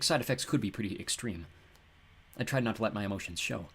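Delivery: ordinary delivery, nothing marked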